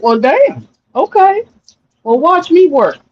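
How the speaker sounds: high-pitched, unfazed retort; defiant comeback. Retold or imitated. Answering someone who doubted her, high defiant comeback. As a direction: toss it back high, taking up the dare.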